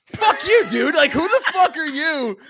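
high, loud comic indignation; outraged retort. Retold or imitated. Car owner to Amazon guy who mocked his Bronco, outraged retort. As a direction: explode in offended disbelief. Retold outrage at delivery man's insult.

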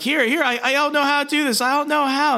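very high, flustered voice; mock panicked pleading. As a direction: imitate someone helpless and begging at gunpoint. Peter pleading, cannot retract claws.

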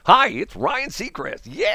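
high, drawn-out country DJ greeting; country radio DJ. Acted. Ryan Seacrest greeting country station listeners, high country DJ voice. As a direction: holler hello like a country radio host. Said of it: "then you turn to the country station".

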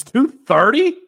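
disbelieving, voice leaping up on the question; surprised. Real, not acted. Host reacting to a player's weight, surprised.

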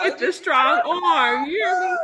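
emotional excited shout. Real, not acted. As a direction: call it out overjoyed.

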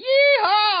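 loud high cowboy whoop; yeehaw. Real, not acted. Host whoops at show start.

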